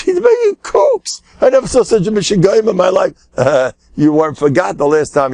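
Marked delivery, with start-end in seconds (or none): 0.0-3.1 mocking shriek of outrage; screaming. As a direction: scream at the other faction with contempt. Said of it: "And he screams".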